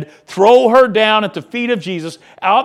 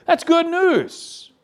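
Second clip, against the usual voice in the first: joyful exclamation; 10 semitones higher, slower than usual. Real, not acted. Preacher exults over healing.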